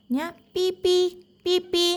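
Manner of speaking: high squeaky peep peep; mouse squeak. Retold or imitated. Mouse shows the puppy its squeak, high and small. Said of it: "ഈ ശബ്ദമാണ് ഉണ്ടാക്കുക".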